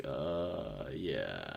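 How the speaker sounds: awkward hesitation. Retold or imitated. Awkward answer to her voice question.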